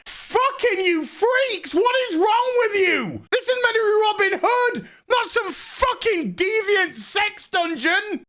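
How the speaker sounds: high, squealing outraged rant; exasperated rant. Real, not acted. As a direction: wail your outrage at the freaks. Rants at the show's makers.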